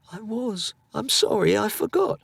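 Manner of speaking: put-on a timid boy owning up; boy apologising. A narrator's reading. Frightened boy apologising to his angry mother.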